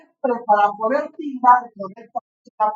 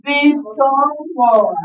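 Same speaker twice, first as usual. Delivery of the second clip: slow teaching emphasis; 2 semitones higher, much slower than usual. Shown as a demonstration. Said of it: "cómo se llama esto".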